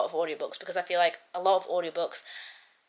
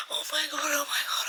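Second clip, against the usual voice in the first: excited gasp; 9 semitones higher than usual. Real, not acted. Reacting to romance scene.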